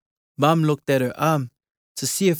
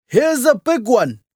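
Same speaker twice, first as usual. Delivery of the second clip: high, loud call of a find; Dad calls out over the rocks, pleased; excited call; 9 semitones higher, 6 dB louder than usual. Acted. Dad calling to Sione about a big mussel, excited and loud.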